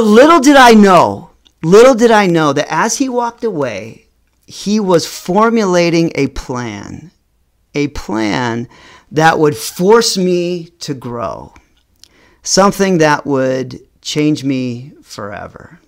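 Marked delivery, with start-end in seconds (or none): none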